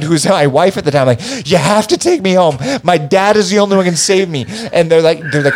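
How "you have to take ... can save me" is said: high-pitched mock plea to be taken home; retold pleading. Tripping teen begging friend to take him home, retold pleading. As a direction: beg like a panicked kid, retold lightly. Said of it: "I remember pleading to my friend".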